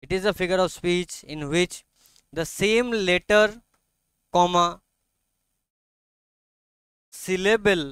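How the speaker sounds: very slow, measured dictation. Real, not acted. Teacher dictates a definition to students, slow and deliberate. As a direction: spell it out phrase by phrase for note-takers.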